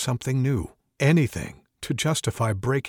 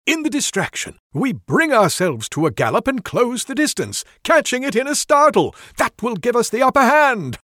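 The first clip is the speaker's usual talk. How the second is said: high, louder excited pitch; eager plan; 9 semitones higher, 7 dB louder than usual. Acted. Wizard explaining his illusion plan to the druid, excited. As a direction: sell the plan with mounting excitement.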